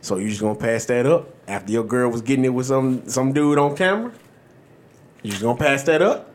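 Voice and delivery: a lightly voiced locker-room buddy taunt; teasing homeboy. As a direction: needle him in a low, egging-on voice. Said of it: "So the next few words go like this".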